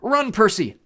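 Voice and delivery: high, loud urgent cry; shouted warning. A narrator's reading. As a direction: yell at a friend to flee.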